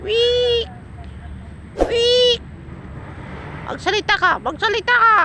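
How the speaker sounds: very high squeaky coaxing call; high pet-talk voice; high bird-talk. Real, not acted. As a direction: sing it up high to coax a bird.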